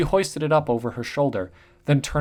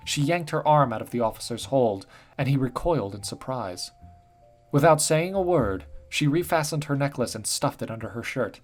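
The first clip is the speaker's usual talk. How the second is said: plain reading voice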